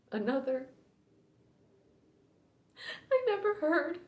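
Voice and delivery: quiet, grieving recluse with long, halting pauses; Allie Mayo. Acted. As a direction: start hushed, let old grief push the pitch up, then sink back. Performs Allie Mayo's grieving monologue.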